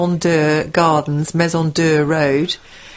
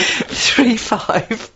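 put-on Scottish for the digits; Scottish accent; 3 semitones higher than usual. Shown as a demonstration. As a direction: read numbers in a mock-Scottish voice. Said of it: "that's much better Scottish accent".

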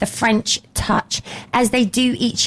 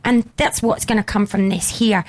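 ordinary talk

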